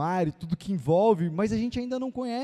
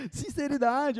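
usual speaking voice

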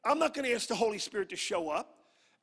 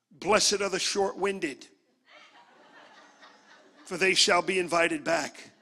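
mock-solemn beatitude; 6 dB louder, slower than usual. Delivers mock beatitude as scripture.

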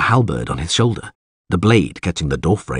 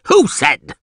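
higher-pitched outburst from the alderman; indignant yell; 6 semitones higher, 4 dB louder than usual. A narrator's reading. Alderman interrupting the villager, yelling. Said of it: "yelled the alderman".

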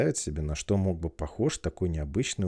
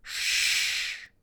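sharp hushing hiss; shushing. Acted. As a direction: hush her sharply. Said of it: "зашикали на неё".

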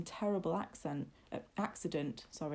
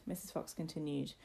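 usual speaking voice

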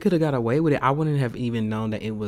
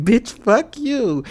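high, loud and drawn out; mock-outraged retort; 9 semitones higher, 6 dB louder, much slower than usual. Snapping back at the chuckling DMV man, mock outrage. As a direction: re-enact an indignant comeback, stretching the words. Acts out her retort to teasing.

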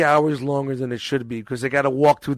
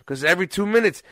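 ordinary talk, nothing marked

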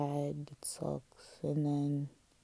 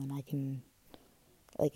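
ordinary delivery

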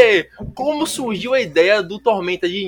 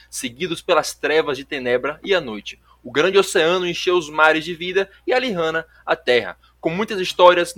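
epic narration; 4 semitones lower than usual. A narrator's reading. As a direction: narrate a legend with gravity. Epic intro narration over music.

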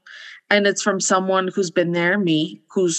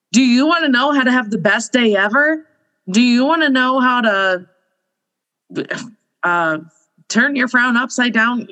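upbeat hook; 4 semitones higher, 3 dB louder than usual. Retold or imitated. Acted engaging post hook.

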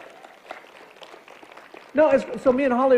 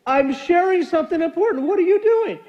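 higher, louder mock complaint; raised imitation of mom; mom's outburst; 8 semitones higher, 5 dB louder than usual. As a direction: play the hurt, indignant mom. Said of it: "And my mom goes".

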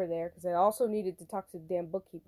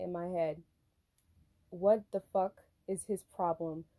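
normal talking voice

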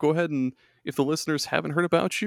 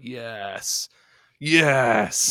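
lightly voiced long-bearded villain. Teasing co-host as lounging long-bearded overlord, mock-evil relish. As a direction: savor it like a long-bearded mastermind, lightly hammed-up. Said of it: "With your long hair and your long beard, you're like".

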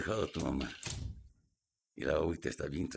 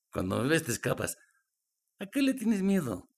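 taunting; 10 semitones higher, 5 dB louder than usual. Acted. Stepfather menacing the girl.

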